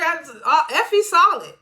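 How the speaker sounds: excited praise. Real, not acted. Own excited reaction to the scene.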